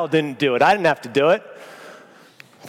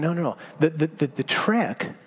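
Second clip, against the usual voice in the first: gentle reassurance; 2 semitones lower, 7 dB quieter than usual. Pretend. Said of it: "God would offer to us a way of saying".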